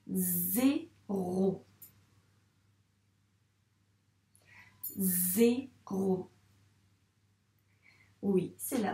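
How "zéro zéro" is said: "Zéro" as zé, pause, ro; long buzzed Z, then a separate "ro" in "Zéro"; syllabes détachées. Shown as a demonstration. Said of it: "dans quelle syllabe tu entends le son Z". (« Zéro » découpé, Z allongé puis ro)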